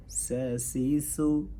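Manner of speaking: سا سی سو with a hissing whistled s, drawn out slowly; whistled s drill. Shown as a demonstration. Teacher models the whistling s for students, slow and hissed. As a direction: whistle the s hard in سا سی سو. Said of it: "سیٹی کی آواز آئے، سیٹی زور سے".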